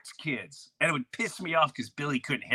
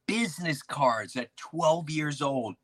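usual speaking voice

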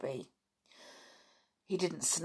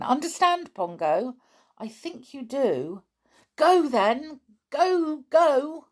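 urging; 8 semitones higher, 5 dB louder, slower than usual. Acted. Nobby sends Pongo off.

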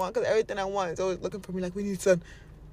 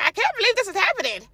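high, louder, exaggerated amazement; mock disbelief; 12 semitones higher, 6 dB louder, faster than usual. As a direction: play someone who can't believe their luck. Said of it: "Don't be like".

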